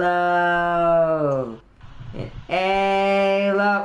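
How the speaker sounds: long, drawn-out reverent chant; mock-worshipful sustained chant; worshiping grunt. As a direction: intone it slowly like a cartoon grunt in worship. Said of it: "Just, they're worshiping Halo".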